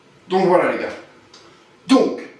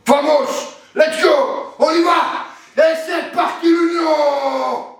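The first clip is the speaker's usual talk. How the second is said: rallying shout; 5 semitones higher, slower than usual. Acted. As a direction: shout the team onto the pitch.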